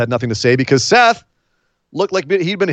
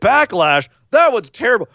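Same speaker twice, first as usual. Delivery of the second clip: higher-pitched, hammed-up superlatives; mock hyperbole; 6 semitones higher than usual. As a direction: parody a friend's all-or-nothing verdicts. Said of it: "hyperbole, that name is Nick".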